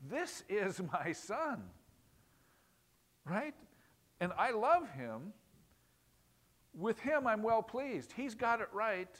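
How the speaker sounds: proud God voice. Retold or imitated.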